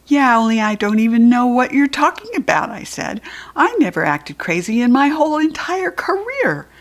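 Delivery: put-on indignant child. Child protesting loudly.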